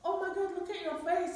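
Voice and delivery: shocked broker. Retold or imitated. Broker coming up to the stricken woman, shocked exclamation. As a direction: react aghast on seeing someone stricken. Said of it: "Terus dia samperin".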